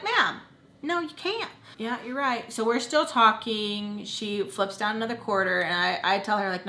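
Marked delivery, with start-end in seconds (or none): 0.0-1.5 high-pitched, drawn-up dismissive quote; high mock "ma'am" voice; mock refusal. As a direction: imitate someone turning a caller down, pitched way up. Imagined authority refusing the caller.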